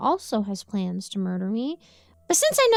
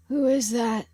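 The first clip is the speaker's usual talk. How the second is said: put-on soft tired old man. A narrator's reading. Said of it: "he asks softly".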